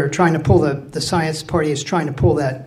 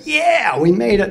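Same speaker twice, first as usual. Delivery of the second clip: relieved cheer; 6 semitones higher than usual. Retold or imitated. Crew cheering behind the door.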